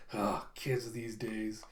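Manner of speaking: low, soft, slow grumble; weary old driver. Acted. Driver muttering about the kids, low slow grumble. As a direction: sigh it like a tired old man.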